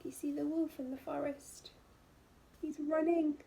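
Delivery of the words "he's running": usual speaking voice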